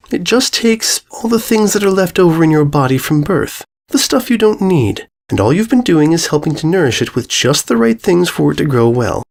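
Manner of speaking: a lightly voiced princess soothing a victim; a hint of a serene but sinister princess; lightly voiced Celestia.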